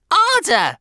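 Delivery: very high, loud screech; piercing egging-on cry; shriek. A narrator's reading. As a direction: shriek for a harder blow. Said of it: "shrieked Missus Pratchett".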